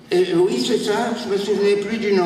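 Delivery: the muddled patient admits forgetting, quick; forgetful patient. Acted.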